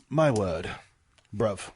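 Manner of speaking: low mock British accent. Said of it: "the British are like".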